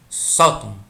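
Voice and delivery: SÓ in "sótão" punched very loud; "sótão" with an exaggerated stressed SÓ; stress on first syllable. Shown as a demonstration. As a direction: shout out the SÓ of "sótão", drop "tão". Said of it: "mais tônica".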